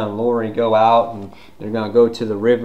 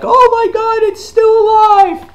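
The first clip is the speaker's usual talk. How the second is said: horrified mock scream; 9 dB louder than usual. Group sees zombie still alive, high mock scream. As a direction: shriek it in hammed-up panic.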